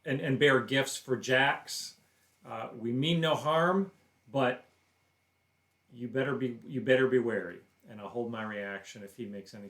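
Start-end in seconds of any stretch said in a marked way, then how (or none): 0.0-4.6 loud, raised declaring voice; bold declaration. Acted. Player tells an armed stranger they come in peace, bold declaration. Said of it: "I will step in and declare". 5.9-7.6 loud, firm warning; declared warning. Acted. Player warns the armed stranger to be careful, loud declaration. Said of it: "I will step in and declare".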